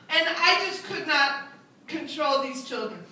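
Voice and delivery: ordinary delivery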